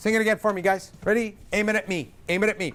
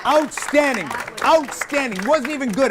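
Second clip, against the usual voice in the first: excited praise; 6 semitones higher, 4 dB louder than usual. Real, not acted. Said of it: "praising the choir".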